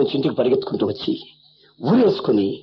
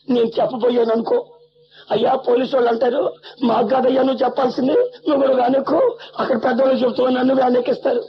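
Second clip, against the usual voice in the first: pleading mother; 8 semitones higher than usual. Retold or imitated. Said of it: "నన్ను పట్టుకుని ఏడుస్తుంది మీ అమ్మ".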